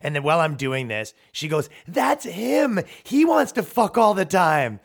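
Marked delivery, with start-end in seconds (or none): 1.9-4.8 high, loud mock yell from the crowd; girlfriend yelling. Girlfriend heckling the comic on stage, loud mock yell. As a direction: blurt it out loud like a tactless girlfriend. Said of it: "his girlfriend yells on the stage".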